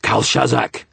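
loud, high snarl; forceful snarling shout; snarled command. A narrator's reading. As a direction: snarl the name as an order. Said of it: "I snarled again".

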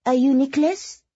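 put-on high young woman's French accent; light, high, heavily French-accented; heavy French accent. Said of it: "asked in the heavy French accent".

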